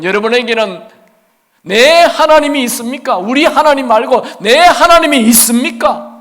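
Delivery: high loud ringing 내 하나님; loud challenging question. Real, not acted. Preacher demanding an answer from congregation, loud and high. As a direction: call out the question to the hall.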